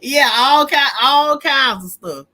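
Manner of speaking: loud laugh spilling into the words; cracking up while talking. Real, not acted. Laughing about late-night viewers.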